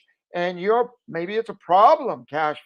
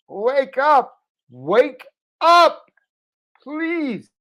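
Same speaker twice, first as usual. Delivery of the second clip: louder, rising wake up call; 9 semitones higher, 5 dB louder, much slower than usual. Real, not acted. To viewers who won't act, a loud wake-up call. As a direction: shout them awake through the mic. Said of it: "I got a big microphone".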